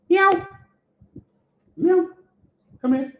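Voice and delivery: very high cat-calling meow. Real, not acted. Owner coaxing cat to come, very high meow call. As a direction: squeak up high to coax the cat over. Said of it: "Sure, sure, cat".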